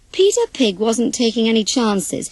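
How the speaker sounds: plain reading voice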